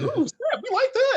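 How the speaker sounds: very high-pitched imitation of delighted fans; mock excited crowd. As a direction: squeal it like a thrilled audience. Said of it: "everybody loved that".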